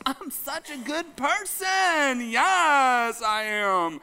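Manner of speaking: mock self-righteous person. Mocking self-righteous churchgoer.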